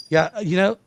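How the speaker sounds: ordinary talk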